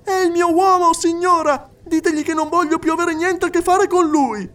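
high, loud furious outburst; a small woman erupting in rage; furious shout. Acted. Little woman shouting at the medium, furious and shrill-high. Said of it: "si alzò infuriata".

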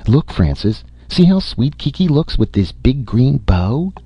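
high sugary cooing voice; cooing mother. Acted. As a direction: coo over the little dog. Mother cooing over dog.

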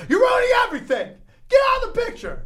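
high, loud mock yelling at the wedding guest; shrieking it out, imitating the comic. Comic imitating a bit, yelling at the man in the wheelchair.

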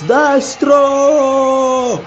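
goal-call shout. Acted. Commentator calls a shot.

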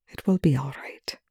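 soft reassuring whisper. A narrator's reading. Rush calming frightened Perry, whispered. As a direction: reassure her under your breath.

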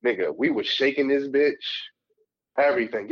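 usual speaking voice

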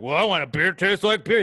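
put-on griping, pushed up and loud; mocking complainer. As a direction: imitate someone scoffing at your drink. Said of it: "people make fun of you".

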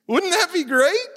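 sarcastic high quip. Real, not acted. Mocking his past self.

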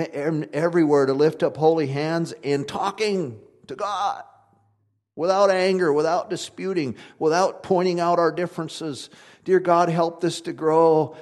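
loud, high, fervent preaching; a preacher hammering his congregation; preaching at full force. Acted. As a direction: lift the church up in a booming prayer. Preaches a mock prayer.

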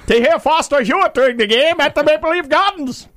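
high, 1940s radio announcer; old-timey radio voice. Acted. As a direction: crank up an old newsreel broadcaster. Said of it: "Doctor J loves my old-timey radio voice".

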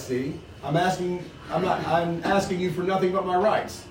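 reading a novel aloud to the group; steady read-aloud with the characters lightly voiced; story reading. To the book group, reading the chapter aloud.

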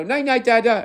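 put-on very high little-kid singsong; toddler goodnight. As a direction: imitate a toddler saying goodnight.

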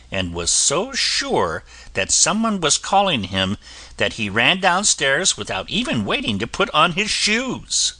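plain reading voice

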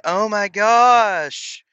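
thrilled the scale finally moved; mock giddy excitement. Dieter seeing first pounds drop, giddy mock excitement. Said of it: "you're like".

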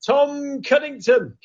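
high, drawn-out name call; announcing a caller. Real, not acted. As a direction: call the guest up like a show host. Host announces the next caller.

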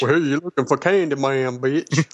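hammed-up Creole accent. Podcaster playing Candyman for laughs, mock Creole accent. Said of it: "That was my Creole".

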